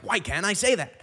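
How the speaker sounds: defensive host. Retold or imitated. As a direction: fire the question back, offended. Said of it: "the host is like".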